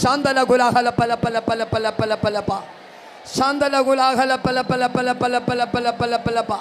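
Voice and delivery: loud, high chant of repeated syllables; speaking in tongues. Real, not acted. Preacher praying in tongues before congregation, loud and ecstatic.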